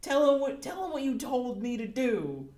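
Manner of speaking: high, loud pleading accusation; accusing demand. Acted. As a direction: insist he confess. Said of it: "he's just like".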